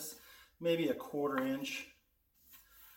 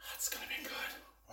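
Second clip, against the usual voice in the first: soft excited whisper; 12 dB quieter than usual. Real, not acted. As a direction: whisper it to yourself. Whispered aside over jar.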